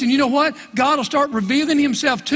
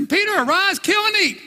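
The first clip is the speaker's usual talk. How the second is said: much higher, ringing divine command; voice of God; 8 semitones higher than usual. Acted. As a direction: call down from heaven, insistent. Voice of God in retelling.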